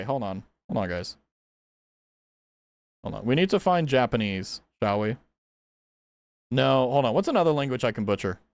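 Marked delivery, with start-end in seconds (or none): 0.0-3.2 much lower, softer mutter to chat; quiet aside. Real, not acted. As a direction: pull back and mumble to yourself. Pausing chat while searching.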